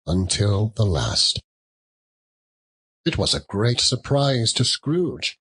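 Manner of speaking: ordinary delivery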